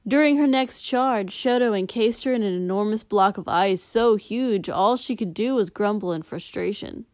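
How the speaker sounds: plain reading voice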